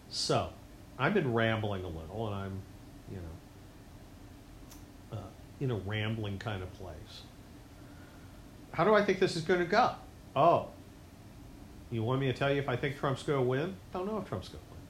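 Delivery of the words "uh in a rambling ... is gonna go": ordinary talk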